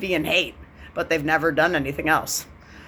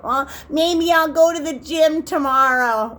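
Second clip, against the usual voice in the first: mock excuse; 8 semitones higher than usual. Mocking lazy talk.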